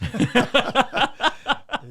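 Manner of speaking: a big, bright burst of laughter; real laugh at half a century of work; hearty laugh. Real, not acted. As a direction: laugh out loud at the thought. Laughs at fifty years.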